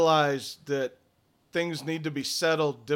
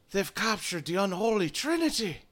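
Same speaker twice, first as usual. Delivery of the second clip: awed druid; 6 semitones higher than usual. Acted. Druids marvel at the relics.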